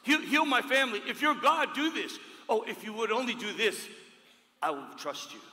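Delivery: high, loud bargaining plea; desperate demand. Acted. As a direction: demand a miracle, pleading hard.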